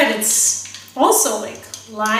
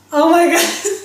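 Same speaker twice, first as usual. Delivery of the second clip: laughing; 5 semitones higher, 6 dB louder than usual. Real, not acted. Laughing at sour taste.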